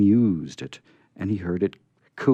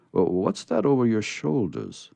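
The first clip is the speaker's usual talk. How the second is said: Santa voice; 3 semitones higher than usual. Acted. Santa questioning.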